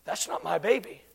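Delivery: low, very soft acted discovery; hushed realization. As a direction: drop to a stunned near-murmur. Mother's sudden quiet realization.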